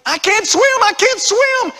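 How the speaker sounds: acted high, loud drowning cry; panicked scream. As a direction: scream it out like a man who has fallen overboard. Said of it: "started screaming".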